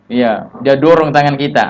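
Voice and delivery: ordinary talk, nothing marked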